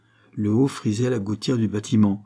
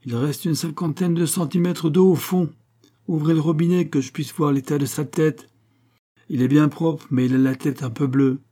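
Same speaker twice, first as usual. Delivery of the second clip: raised, high-pitched report; calling out a finding; 6 semitones higher, 3 dB louder than usual. A narrator's reading. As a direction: call it down to the others. Volpi calls down from tank.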